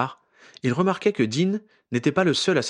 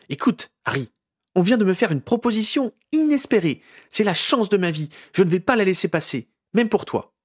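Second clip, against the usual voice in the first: a lightly voiced Ginny, higher and eager; eager young woman; 9 semitones higher, 4 dB louder than usual. A narrator's reading. Ginny tells Harry of her big chance, eager and higher. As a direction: lift her voice with the thrill of the offer.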